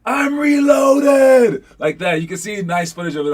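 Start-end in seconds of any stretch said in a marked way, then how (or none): 0.0-1.6 loud, high, drawn-out movie-hero yell; imitated scream. Bluffing gunman announces he has reloaded, imitated scream. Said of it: "he just screams out".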